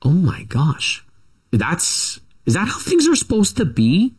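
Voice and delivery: a lightly voiced wide-eyed child; stunned kid. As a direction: voice a shocked little kid. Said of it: "and you're going".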